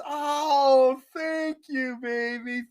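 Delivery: a light, fond impression of Grammy; quoting Grammy. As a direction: lift the pitch and coo it sweetly. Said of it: "And she goes".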